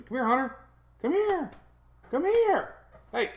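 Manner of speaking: very high, loud sing-song calling; rising to a squeaky high, coaxing call; high puppy call. Real, not acted.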